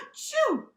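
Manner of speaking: big, high, loud achoo; loud sneeze. Acted. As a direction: let rip a hammed-up sneeze. Fur tickles nose, sneezes.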